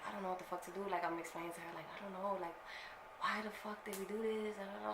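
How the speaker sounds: put-on scared teen whispering to her girlfriend in the bedroom, hushed; hushed panic.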